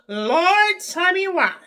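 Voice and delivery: high loud cry of disbelief; loud high tell me why; exasperated cry. Real, not acted. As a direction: throw it up to the heavens. Calls out to the Lord.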